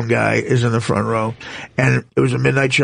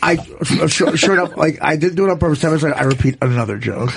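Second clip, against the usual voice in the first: usual speaking voice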